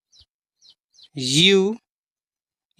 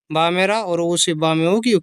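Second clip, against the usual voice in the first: ordinary delivery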